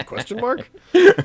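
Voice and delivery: lightly voiced, confused, rising high; a puzzled stranger, mock high; confused author. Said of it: "their response would just be".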